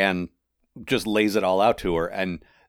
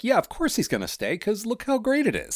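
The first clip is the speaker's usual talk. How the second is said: mock gushing; 14 semitones higher than usual. Gushing about his new life.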